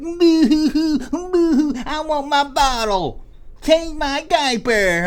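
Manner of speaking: put-on high, slow bawling baby voice; boo-hoo it like a spoiled baby doll; whiny crying baby. Mocking the crying doll toy, whiny baby voice.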